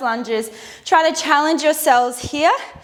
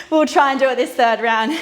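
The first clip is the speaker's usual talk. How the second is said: normal talking voice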